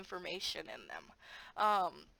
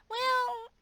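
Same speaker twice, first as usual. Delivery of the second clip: high, louder, slowing to a coaxing finish; gently steer a student toward the answer, higher and louder; encouraging teacher voice; 8 semitones higher, 7 dB louder, much slower than usual. Shown as a demonstration.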